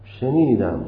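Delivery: usual speaking voice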